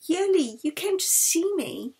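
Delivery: put-on a feverish girl lighting up at her friend; weak sick girl. Sick girl greeting her friend, frail and glad.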